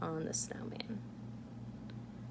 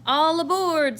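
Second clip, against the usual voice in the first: loud, much higher call; shouted; 10 semitones higher, 12 dB louder than usual. Acted. Santa calling passengers like a conductor, loud. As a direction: call all aboard like a conductor. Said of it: "Santa shouted".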